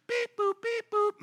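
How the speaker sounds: high mechanical beeps; robot beeps. Pretend. God mocked as programming people, robot beeps. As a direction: beep like a toy robot.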